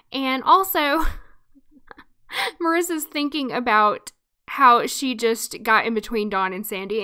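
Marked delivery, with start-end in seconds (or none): none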